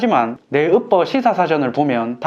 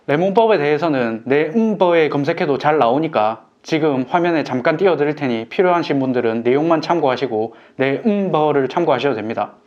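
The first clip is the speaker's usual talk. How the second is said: ordinary delivery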